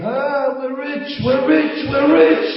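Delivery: building from high to loud joyful screaming; ecstatic scream. Acted. Wife screams to wake her husband, ecstatic scream. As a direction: cry out like you just struck gold. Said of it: "his wife starts to scream".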